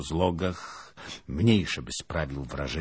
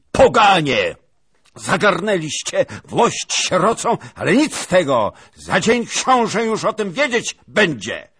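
much higher, loud furious cry; shouting threat; 15 dB louder than usual. Acted. Lieutenant shouts threats at the princes. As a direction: shout the threat in their faces. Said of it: "Namiestnik zaś wołał".

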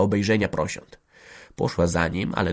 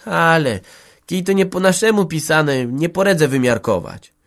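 discouraged reply; 8 semitones higher, 4 dB louder than usual. Acted. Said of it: "odparła zniechęcona".

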